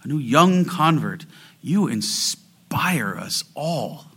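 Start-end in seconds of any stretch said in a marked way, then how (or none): 1.6-4.1 slow, hushed whisper. Real, not acted. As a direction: drop to a tender whisper. Tender praise of aged worshippers.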